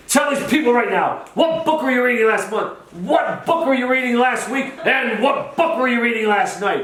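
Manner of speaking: very high, relentless hollering; mock hollered interrogation. [name] demands answers before the crowd, mock hollered interrogation.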